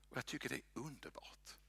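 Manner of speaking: soft quick whisper; whispered aside. Real, not acted. Preacher confides his delight to the congregation in a whisper. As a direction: confide it quietly. Aside about loving the text.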